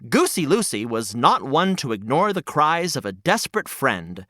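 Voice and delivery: ordinary delivery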